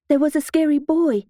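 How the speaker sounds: lightly voiced frightened child; scared child. A narrator's reading. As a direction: report it like a scared little kid. Frightened child reports what he saw.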